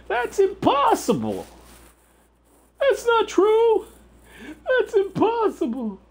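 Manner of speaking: very high, slow silly voice; squeaky mock voice. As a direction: squeak out fake comfort. High put-on voice consoling himself.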